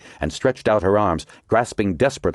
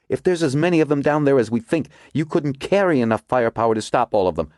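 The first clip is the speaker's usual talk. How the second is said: higher, urgent woman character; Margot's voice; 8 semitones higher than usual. A narrator's reading. Margot explains her theory to the agents, urgent and higher. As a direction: voice Margot pressing her discovery.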